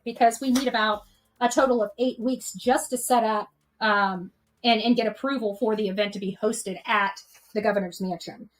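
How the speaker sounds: usual speaking voice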